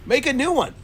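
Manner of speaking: high-pitched, mock-outraged objection; indignant protest. Customer objecting to restaurant staff about the order, mock indignant.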